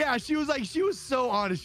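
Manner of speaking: high excited. Real, not acted. Emphatic aside about the woman.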